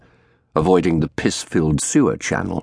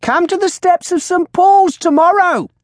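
high, loud, shrill shout; shouting crone; 5 dB louder than usual. A narrator's reading. Old woman advertising to the crowd, shrill shout. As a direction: shout like a street showwoman. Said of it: "the crone shouted".

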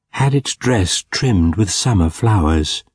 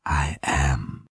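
much softer and lower, as if from afar; soft, low and gentle; 6 semitones lower, 8 dB quieter than usual. A narrator's reading. The ghost answers Scrooge, soft, low and gentle. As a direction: answer softly and low, like a distant voice. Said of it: "The voice was soft and gentle, singularly low".

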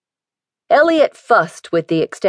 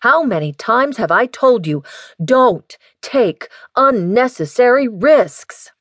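scolding; 6 semitones higher than usual. A narrator's reading. Father scolding daughter.

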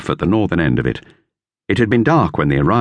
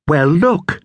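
higher, lightly voiced boy; boy's voice, lifted higher; Dick; 10 semitones higher than usual. A narrator's reading. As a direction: read it as young Dick. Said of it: "said Dick".

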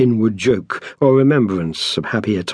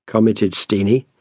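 usual speaking voice

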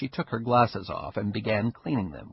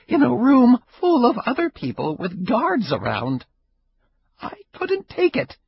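put-on higher old woman, building hurt and anger; old Ida, reproachful; 10 semitones higher, 6 dB louder than usual. Ida scolding Caperuzzi for not warning her, higher and rising. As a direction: pour out the grievance.

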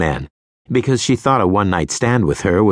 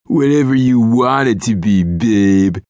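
higher oily flirt; sleazy pickup voice; 4 semitones higher, 4 dB louder than usual. A narrator's reading. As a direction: smirk through it. Sleazy guy.

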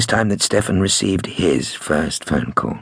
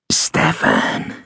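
low, sinister phone whisper; whispering hiss; 11 semitones lower than usual. A narrator's reading. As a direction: hiss the name in a drawn-out whisper. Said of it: "broken by a whispering voice".

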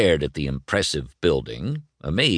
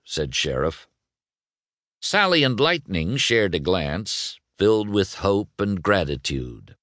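usual speaking voice

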